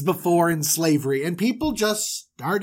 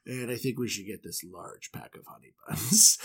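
imitating dad; 7 semitones lower, 6 dB quieter than usual. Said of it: "my dad would always be like".

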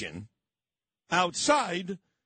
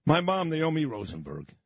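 ordinary talk, nothing marked

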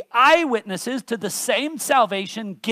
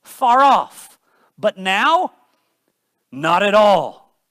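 loud, punched "Far off"; emphatic preaching; 9 dB louder, slower than usual. Acted. Preacher driving home that the Gentiles are far off no more, loud. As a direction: ring it out, then drive "not at all" slowly.

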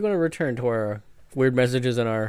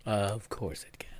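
sarcastic whisper; 3 semitones lower, 9 dB quieter than usual. Real, not acted. Sarcastic aside.

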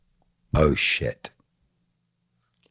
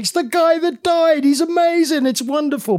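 much higher, loud, delighted fangirl voice; gushing staff; 6 dB louder than usual. Retold or imitated. Hospital staff greeting the patient who died, high and gushing. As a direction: squeal it like meeting a celebrity.

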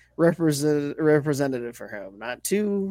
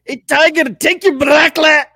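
loud, high, botched Scottish accent; 11 semitones higher, 10 dB louder than usual. Shown as a demonstration. Reluctant guest kicking out a golfer on request, shouted Scottish accent. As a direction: bellow it like a cartoon Scottish groundskeeper. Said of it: "but in a Scottish accent".

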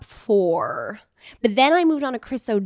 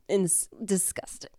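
whispering; 7 dB quieter than usual. Real, not acted. Embarrassed aside.

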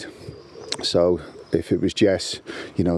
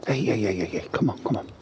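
quick repeated call to a dog; 2 semitones higher, 5 dB quieter than usual. Real, not acted. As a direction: get the dog's attention. Calling the barking dog.

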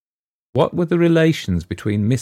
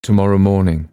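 blurted out, louder; sudden cry; 6 dB louder than usual. A narrator's reading. Daughter cuts in on her father, sudden louder cry. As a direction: cut in before anyone can refuse. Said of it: "cried Miss Lombard, speaking suddenly".

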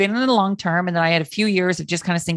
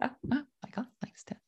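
whispered aside; 6 semitones higher, 16 dB quieter than usual. Retold or imitated. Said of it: "I said".

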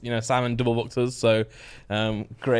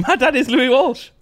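slow, high put-on Irish voice; high Irish accent; 11 semitones higher, 4 dB louder, slower than usual. Answering co-host about his dad, high slow mock Irish. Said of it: "Why's your voice high?".

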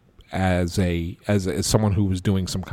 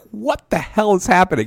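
alarmed; 9 semitones higher than usual. Retold or imitated. Colleague freaking out in press box.

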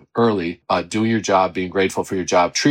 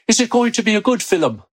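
put-on high, lilting Irish accent; Irish accent, pitched well up; mom's Irish accent; 12 semitones higher than usual. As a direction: imitate an Irish mother asking. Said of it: "in her Irish accent".

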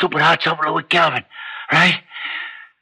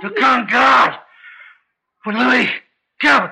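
higher, shouted triumph; shouting; 8 semitones higher, slower than usual. Acted. Father shouting down his protesting daughter, triumphant yell. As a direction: shout it over her.

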